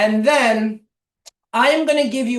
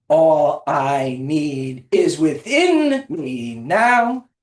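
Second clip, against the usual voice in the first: "within" drawn out and emphasized in a slow chant; stress on within; 5 semitones lower, much slower than usual. Shown as a demonstration. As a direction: lean on "within", stretching it. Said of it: "stress on the different words".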